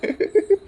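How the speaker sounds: high, rising amused laugh; short playful chuckle; teasing laugh. Acted. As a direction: laugh at her suggestion. Husband laughs again, teasing.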